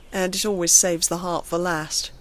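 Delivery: ordinary delivery, nothing marked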